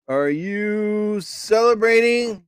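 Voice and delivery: careful slow sing-out of each word; slow dictation. Real, not acted. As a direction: stretch each word for a translation machine. Dictating a question to translate.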